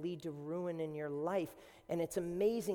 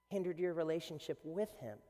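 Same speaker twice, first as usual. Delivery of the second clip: ordinary delivery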